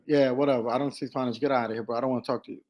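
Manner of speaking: low, soft, bored brush-off; dismissive brush-off. Retold or imitated. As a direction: wave him off, deliberately uninterested. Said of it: "I did it on purpose".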